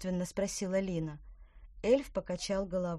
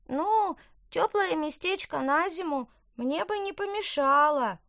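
high, bright little elf; squeaky elf speaking; 11 semitones higher, 6 dB louder than usual. Acted. Elf admits.

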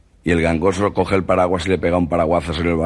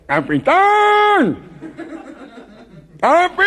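very high, drawn-out call from far below; nasal man yelling for the captain; nasal gangoso calling out; 3 dB louder, much slower than usual. Retold or imitated. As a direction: shout up the hill, nasal and slow. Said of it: "la voz del gangoso que decía".

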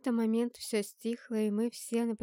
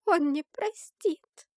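put-on distraught girl, higher and louder; trembling voice; 8 semitones higher, 6 dB louder, much slower than usual. A narrator's reading. Girl answering the narrator that he will not forgive, in a trembling voice. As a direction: quaver it out slowly, raised in pitch. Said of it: "дрожащим голосом ответила девушка".

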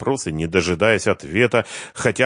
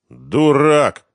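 contemptuous; 9 semitones higher, 8 dB louder than usual. Acted. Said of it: "презрительно сказал".

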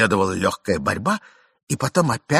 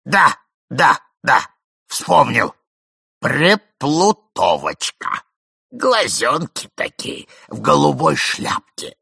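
put-on slow, louder old man; doddering old man; 4 semitones higher, 5 dB louder, slower than usual. A narrator's reading. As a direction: mumble on forgetfully.

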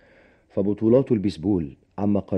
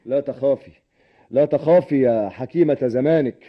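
raised, sarcastic reassurance; mocking; 7 semitones higher, 6 dB louder than usual. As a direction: jeer at her worry, loud and high. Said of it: "لماذا تسخر مني". (بسخرية وتهكم)

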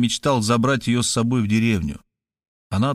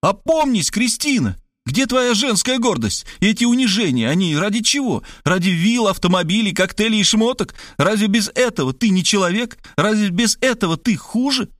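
raised outcry; 10 semitones higher than usual. A narrator's reading. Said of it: "Я взвыл".

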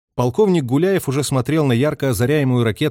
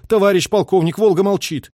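high, raised-voice report; alarmed signalman's report; 8 semitones higher, 5 dB louder than usual. A narrator's reading. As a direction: call the bad news out to the colonel. Radio operator reports lost contact.

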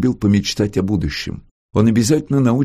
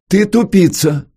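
high, louder, drawn-out teasing call; raised voice; 11 semitones higher, 6 dB louder than usual. A narrator's reading. Brother teasingly insults his sister across the room, raised voice. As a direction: call it out slowly, loud and high. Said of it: "Он повысил голос".

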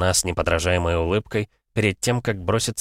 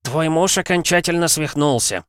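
father-in-law voice; 11 semitones higher, 3 dB louder than usual. A narrator's reading. Zaitsev calls his daughter.

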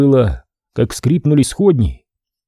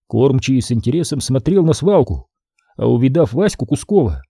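ordinary delivery, nothing marked

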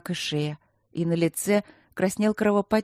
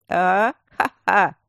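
loud coarse guffaw; 2 semitones higher, 7 dB louder, much slower than usual. Acted. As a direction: laugh out loud, honking. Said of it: "Продолжил гоготать он".

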